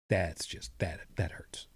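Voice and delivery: mock hurt whisper. Mock pain over never-made episode.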